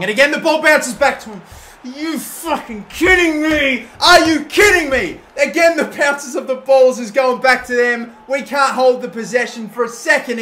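loud, high, exasperated yelling; furious shout. Real, not acted. Frustrated manager shouting at his team losing possession, furious yell. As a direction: bellow in disbelief at the bounces.

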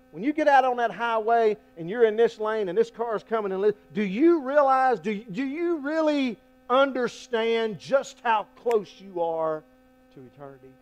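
loud, high, driving preacher voice; preaching at full force. Acted. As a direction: preach it out hard to the room. Preacher building urgency.

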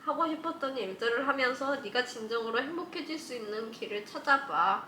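작고 차분하게 편지 읽기; 진심 담아 조용히 읽어주기. Acted. (조용한 편지 낭독 말투)

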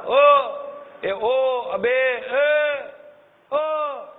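drawn-out rough calls of a scolding father; slow, stretched mock yells at a child; mock angry yelling. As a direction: holler at the kid like an angry dad.

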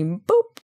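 a high, bright little boop; boop sound. Acted. Interviewer sounding off the computer for the candidate. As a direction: pop out a cartoon computer boop. Mimics a computer pulling up info.

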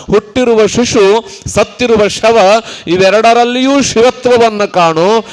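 measured, forceful sermon cadence; rousing exhortation. Real, not acted. Preacher telling devotees to see the sacred everywhere, rousing exhortation. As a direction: urge each command on the congregation.

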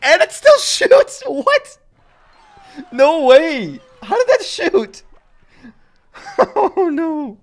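high, loud squeals of disbelief; shocked disbelief. Real, not acted. Streamer shocked his tower shot survives, squealing high. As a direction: shriek like you can't believe it.

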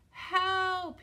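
loud, high drawn-out call; cry for help. A narrator's reading. Trapped Tyro cries out as Tiny creeps closer, loud high cry. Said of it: "he heard Tyro cry again".

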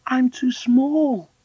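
put-on high, slow child's voice; protesting small girl. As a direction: wail it slowly, high up. Deedy protests her size.

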